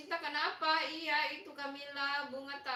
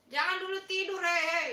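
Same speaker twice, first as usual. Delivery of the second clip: higher, louder calling-out; urgent protest; 3 semitones higher, 6 dB louder, faster than usual. Real, not acted. Host stops viewers going off to sleep, urgent protest. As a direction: stop them with a raised voice.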